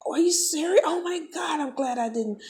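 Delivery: high, tumbling disbelief; flustered shock. Retold or imitated. Teller relives learning he threw at a squirrel, flustered shock. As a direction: stammer it out high, cutting yourself off. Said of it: "I couldn't even finish my sentences".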